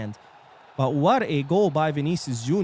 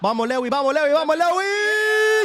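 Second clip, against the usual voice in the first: excited cheering; 5 dB louder than usual. Real, not acted. Fan cheering live goal chance.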